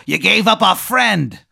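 high, loud creature accusation; accusing monster. Acted. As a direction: cry out the betrayal as an outraged monster. Both monsters accuse the player.

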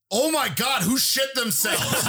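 high, drawn-out mock holler; appalled outcry. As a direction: cry it out in hammed-up disgust. Retold bystander's outcry at a smell.

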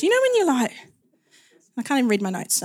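ordinary delivery

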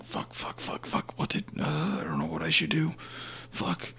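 frantic high whisper, near breathless; panicked whisper. Retold or imitated.

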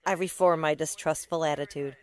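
usual speaking voice

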